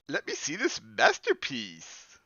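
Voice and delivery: put-on high, slow teasing girl voice. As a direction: sing it out with a grin. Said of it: "grinning at me".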